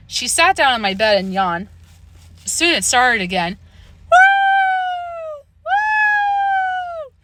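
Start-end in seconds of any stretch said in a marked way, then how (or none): 4.1-7.1 ghostly howl. A narrator's reading. The noise starts again, a high long howl. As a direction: howl like a baying dog.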